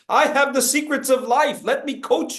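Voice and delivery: lightly hammed-up self-important coach; a touch of a pompous life coach; mock guru. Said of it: "what do I call this gesture when you're like".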